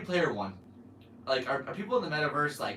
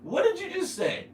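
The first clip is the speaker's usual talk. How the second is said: disbelief; 4 dB louder than usual. Real, not acted. Stunned by the comment.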